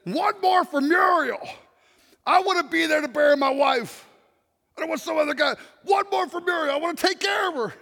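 put-on quoting the old runner's rallying shout; fervent cry. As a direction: shout it like a vow. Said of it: "raises his arms in the air and says".

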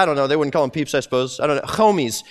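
ordinary delivery

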